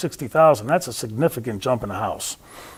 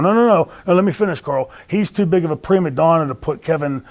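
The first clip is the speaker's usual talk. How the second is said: retold insistent interruption; 4 semitones higher than usual. Wrestler refusing to let Carl interrupt, retold insistence. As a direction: talk over the objection, imitating a heckler. Acted talking over Carl's objection.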